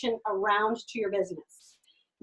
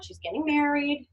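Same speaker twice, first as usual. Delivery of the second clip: normal talking voice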